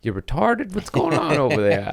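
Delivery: high questioning mock heckler; a lightly voiced heckler; mild mock quizzing; mock audience heckle. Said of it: "They're like".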